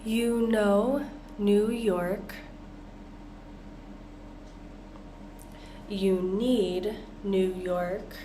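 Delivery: slow, even read of You know New York for the class; slow choral practice; slow practice pace. Shown as a demonstration. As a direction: say it slowly so learners follow. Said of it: "Now let's practice together".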